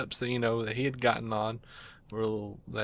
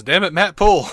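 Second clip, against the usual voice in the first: loud, higher-pitched urgent yell; retold yell; 6 semitones higher, 10 dB louder than usual. Man hanging from a ditch wall to his friend, mock yell. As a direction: yell it at a friend hauling you up. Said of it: "all I yelled was".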